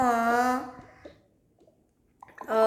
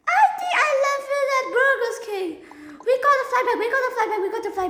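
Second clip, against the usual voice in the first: fast, high, loud pleading; panicked outburst; 11 semitones higher, 5 dB louder, much faster than usual. Acted. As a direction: blurt it out in a frantic rush. Child panics over iPad left behind.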